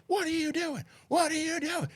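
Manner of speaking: high-pitched imitation screech; retold screaming. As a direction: mimic an angry woman screaming from her car.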